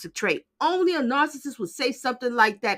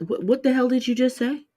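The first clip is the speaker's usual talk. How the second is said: normal talking voice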